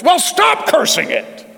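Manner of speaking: slow, high, ringing rebuke; a hammed-up booming heavenly rebuke; voice of the Lord. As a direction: proclaim it slowly like a divine correction. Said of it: "I hear that voice".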